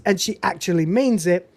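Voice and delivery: usual speaking voice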